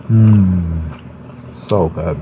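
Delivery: savoring hums while chewing; mouth full. Real, not acted. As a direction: hum through a mouthful of food. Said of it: "talk with my mouth full because I'm eating".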